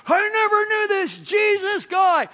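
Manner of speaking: high, loud swearing denial; swearing Peter. Acted. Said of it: "He's swearing".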